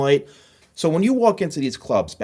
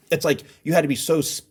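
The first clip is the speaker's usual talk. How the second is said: ordinary talk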